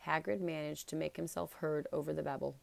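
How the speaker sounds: ordinary delivery, nothing marked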